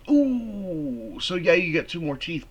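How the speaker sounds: high, changed-voice ooh; impressed ooh. Real, not acted. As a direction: react to the burn. Reacting to insult.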